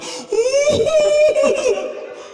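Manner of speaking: long high mock sobbing howl; imitated wail. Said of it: "Dia teriak". (meniru raungan tangisan yang tinggi dan panjang)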